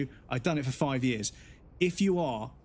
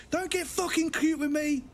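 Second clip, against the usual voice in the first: much higher squawking mock rage; mock abusive caller; 13 semitones higher than usual. Rude caller swears at the call agent in high mock fury. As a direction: ham up a screeching irate customer.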